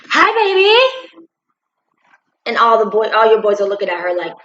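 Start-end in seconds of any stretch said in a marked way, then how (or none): 0.1-1.3 high, slow, sugary fake greeting; fake sweet greeting. Boyfriend greeting girlfriend, high sugary fake hello. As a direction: sing it out falsely sweet. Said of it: "here she comes".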